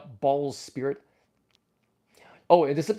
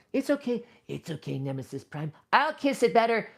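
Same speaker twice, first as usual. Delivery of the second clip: kissing it better; 6 semitones higher than usual. Pretend. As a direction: hush a hurt plush tenderly. Comforting hurt toy.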